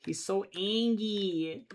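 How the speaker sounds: put-on slow, drawn-out baby-talk coo; slow affectionate coo. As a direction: coo at the little angry drawing. Cooing over grumpy drawn character.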